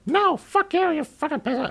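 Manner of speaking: woman cursing the pursuing cop, very high screech. Retold or imitated. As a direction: shriek it at him then trail off.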